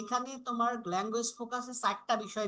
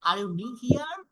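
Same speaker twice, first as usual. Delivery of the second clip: here rises at the end of the question; rising intonation; 5 semitones higher, 6 dB louder, much slower than usual. Shown as a demonstration. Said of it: "voice কিন্তু আমার up হচ্ছে". (here শব্দে স্বর উপরে ওঠানো)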